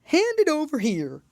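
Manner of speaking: high, loud character voice; loud, high-pitched order from the Dodo; commanding Dodo. A narrator's reading. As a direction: voice a bossy Dodo, pitched right up. Dodo figure in narrated story.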